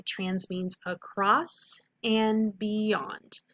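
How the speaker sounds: very slow call-and-repeat chant; slow vocab drill; slow choral model. Shown as a demonstration. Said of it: "one more time".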